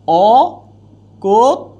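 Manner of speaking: অকথ্য drawn out slowly; slow demo pronunciation. Shown as a demonstration. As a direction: stretch the word out as a model. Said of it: "teacher demonstrates pronunciation".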